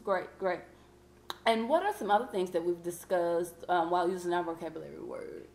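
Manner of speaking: ordinary talk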